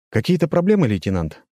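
slightly careless question, opening high and then dropping; slightly offhand. A narrator's reading. Convoy leader approaching a patrol lieutenant, slightly offhand. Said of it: "немного небрежно спросил я".

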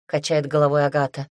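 ordinary delivery

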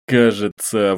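ordinary delivery, nothing marked

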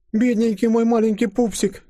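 very high, doting fussing voice; squeaky pitying baby-talk; Катенька. A narrator's reading. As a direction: fuss like a mother hen. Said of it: "Словно курица-наседка засуетилась Катенька".

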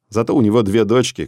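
usual speaking voice

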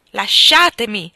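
loud, slow furious demand; trembling with anger. A narrator's reading. Helen shouts at Hargrave to let go, trembling with rage. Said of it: "tremando di rabbia".

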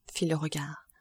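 plain reading voice

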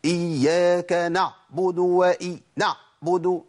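إياك نعبد with a doubled, stressed ع, slow; تقليد خطأ الشدة في العين; imitating a sheikh's error. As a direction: mimic a reciter who adds shadda on the ع. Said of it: "تلاقي الشيخ وهو بيقرأ".

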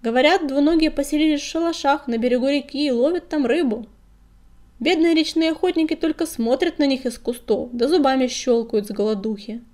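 lively voiced retelling of rumours; emphatic agreement. A narrator's reading. Белоснежка adds rumours of twolegs, emphatic agreement. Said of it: "поддержала старуху Белоснежка".

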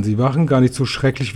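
ordinary delivery, nothing marked

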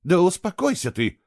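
put-on woman snapping at the boy to calm down; snapped. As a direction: cut him off. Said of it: "отрезала она".

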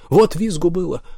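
high-pitched, excited exclamation; gleeful bragging. A narrator's reading. Olli boasts of the squealing he caused, high and gleeful. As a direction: crow about it, voice lifted high.

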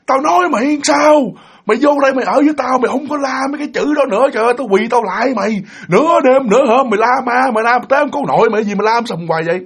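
high-pitched exasperated rant; angry scolding. Retold or imitated. Woken big brother scolds younger sibling, angry rant. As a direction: tell off a noisy sibling, fast and high.

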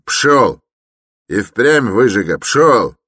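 high, forceful dismissal; angry shout. A narrator's reading. Huge porter throws Raskolnikov into the street, shouting angrily. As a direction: drive him off with loud commands.

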